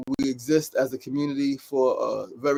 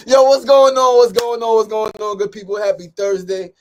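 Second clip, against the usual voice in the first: loud, high hype shout-out that eases down; excited greeting; 6 semitones higher, 8 dB louder than usual. Real, not acted. Greeting his viewers again, loud, high and hyped. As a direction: fire up the crowd with a big yo.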